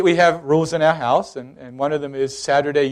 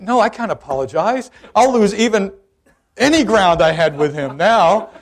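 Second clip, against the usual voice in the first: high, louder objection; protesting; 5 semitones higher, 5 dB louder than usual. Retold or imitated. Father objecting to the Lord about apologizing, raised protest. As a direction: refuse in a raised, pleading-to-argue voice.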